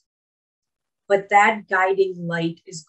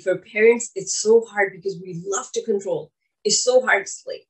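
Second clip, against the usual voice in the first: ordinary talk, nothing marked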